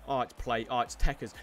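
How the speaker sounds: usual speaking voice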